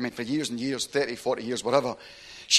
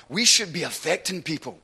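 ordinary delivery

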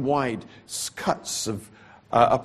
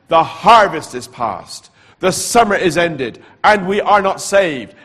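loud, slow, higher proclamation; louder still; 5 semitones higher, 10 dB louder, slower than usual. Retold or imitated. Preacher voicing the night voice, loud and slow. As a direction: declare the warning like a booming call. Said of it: "the voice said it louder".

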